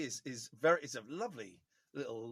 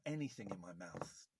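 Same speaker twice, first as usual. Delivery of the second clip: ordinary talk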